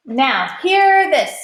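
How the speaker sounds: high, loud call; captain Patrick announcing to all; megaphone announcement. A narrator's reading. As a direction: boom it through a megaphone. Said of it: "announced from his mega megaphone".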